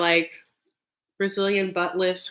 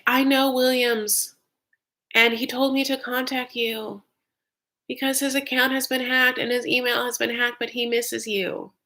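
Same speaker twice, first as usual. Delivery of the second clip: message read-out; 5 semitones higher than usual. Acted. Acting out the suspicious DM.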